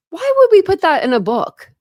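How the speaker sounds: high, loud incredulous question; exasperated disbelief. Real, not acted. Reader baffled by a word in the book, exasperated disbelief. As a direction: protest loudly, pitched right up.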